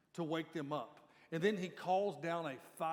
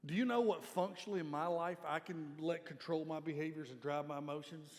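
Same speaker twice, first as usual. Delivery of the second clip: ordinary talk, nothing marked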